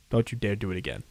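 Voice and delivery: ordinary talk, nothing marked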